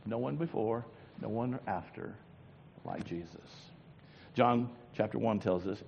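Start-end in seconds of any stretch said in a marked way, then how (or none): 0.0-3.3 hushed. Acted. Hushed after loud climax.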